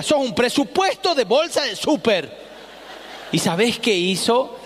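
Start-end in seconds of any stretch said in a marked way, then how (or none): 0.0-2.4 high mocking mother voice, pitched well up; mom scolding. Mother teasing her son about his bag, high and mocking. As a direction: jab at him like a fed-up mom. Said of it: "y mi vieja me decía".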